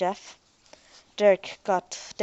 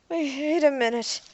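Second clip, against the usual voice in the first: wheezing; 6 semitones higher than usual. A narrator's reading. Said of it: "Derek wheezed".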